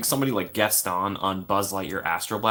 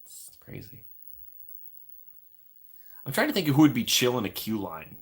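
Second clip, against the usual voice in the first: usual speaking voice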